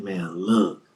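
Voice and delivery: hushed secretive whisper. Retold or imitated. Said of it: "he said".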